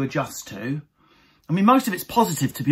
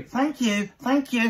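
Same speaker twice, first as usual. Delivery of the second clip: higher, brisk "thank you" twice; mock wife thanking herself; 8 semitones higher, slower than usual. Wife thanking herself, higher mock voice. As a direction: thank an invisible assistant. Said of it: "Lindsay thanks herself".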